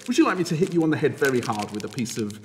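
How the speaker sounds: a hint of a bully's threat; mock menacing offer. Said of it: "I could also say".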